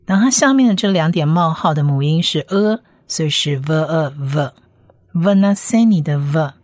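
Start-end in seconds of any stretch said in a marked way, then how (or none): 3.6-4.5 "V呃V" as v, uh, then joined as vuh; syllable blending. Shown as a demonstration. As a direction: sound out "V呃V" piece by piece. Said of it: "Vav发音发V的音". (分步拼读“V呃V”：先V，再呃，最后合读)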